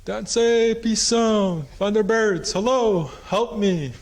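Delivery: high, loud desperate pleading; crying out for help. Retold or imitated. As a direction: scream for help from under a boulder. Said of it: "He started crying out to, for help".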